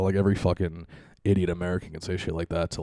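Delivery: ordinary talk, nothing marked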